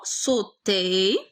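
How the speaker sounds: very slow, stretched صوتي with a rising end; slow dictating voice, syllables held long; drawn-out dictation. Shown as a demonstration. Drawn out while writing the verb.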